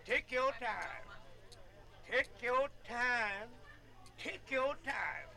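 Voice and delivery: drawn-out, unhurried take your time; slow and easy. Acted. Old man recalling slow courting, drawn out and unhurried. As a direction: stretch it out slowly like old folks courting.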